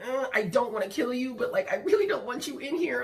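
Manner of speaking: high, whiny panicked plea; mock-panicked, pitched well up; squeamish pleading. Pleading with cockroach, retold.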